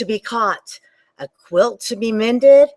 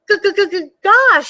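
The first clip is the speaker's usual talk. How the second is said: much higher reindeer voice, stammering with cold; stuttering cold reindeer; 11 semitones higher, 4 dB louder than usual. Acted. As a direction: shiver out the gosh. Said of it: "said the reindeer".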